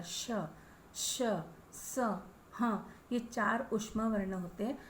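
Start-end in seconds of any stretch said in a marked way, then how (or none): 0.0-2.2 "श, श, स" hissed out with breath; hissing sounds. Shown as a demonstration. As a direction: let the air hiss through on "श, श, स". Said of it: "देखिए आप बोल करके एक बार". (गर्म हवा के साथ "श, श, स" बोलिए)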